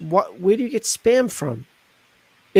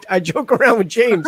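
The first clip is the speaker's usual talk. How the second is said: ordinary talk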